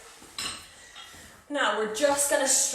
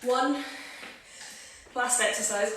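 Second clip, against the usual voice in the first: normal talking voice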